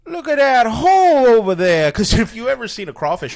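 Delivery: put-on very high and loud hillbilly Southern accent; goofy Southern guy. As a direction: squeal it like an awestruck Southern yokel. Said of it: "a guy's head from the South".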